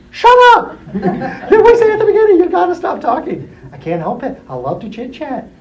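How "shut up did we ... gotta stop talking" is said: very high, loud laughing mock yell; exasperated yell. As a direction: snap at the chatterers, half laughing. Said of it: "And like".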